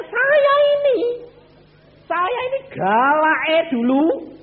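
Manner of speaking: high, loud, slow declaration; emphatic shout. Real, not acted. Preacher loudly confesses how fierce he used to be. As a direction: proclaim it like a confession.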